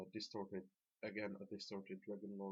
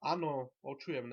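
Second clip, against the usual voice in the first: raised, high call back; 5 semitones higher, 9 dB louder than usual. Real, not acted. Player answering groupmates mid-recording, calling out. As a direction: answer loudly over to someone. Answers teammates in another language.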